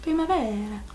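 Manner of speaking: lilting Italian-accented Primavera. Shown as a demonstration. Vlogger repeating the shade name for fun, in an Italian accent. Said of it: "say that in an Italian accent".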